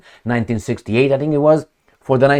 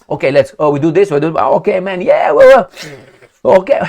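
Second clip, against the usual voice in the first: mock dithering leaders; 3 semitones higher, 4 dB louder than usual.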